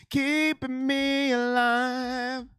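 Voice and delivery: very slow, elongated words; heavily stretched thanks. Real, not acted. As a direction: drag the phrase right out in gratitude. Repeat of donor thanks, stretched further.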